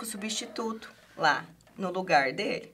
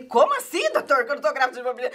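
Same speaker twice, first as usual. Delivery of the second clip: shocked disbelief; 4 semitones higher, 3 dB louder, much faster than usual. Retold or imitated. Retold shock at doctor's news.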